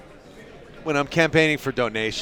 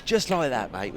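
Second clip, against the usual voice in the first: put-on English accent; 3 semitones lower, 5 dB quieter than usual. Streamer calling the score to viewers, English accent. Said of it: "it's my bloody English accent".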